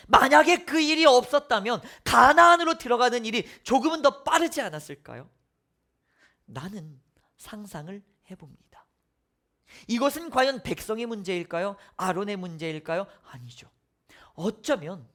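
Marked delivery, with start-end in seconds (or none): 6.4-8.8 whispered reflection; preacher whispering a private thought. Real, not acted. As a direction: drop to a hushed whisper.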